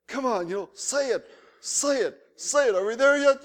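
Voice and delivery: put-on a bored kid hurrying the preacher along; impatient pleading. Churchgoers urging a long-winded pastor to say amen, impatient and loud. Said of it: "and were just".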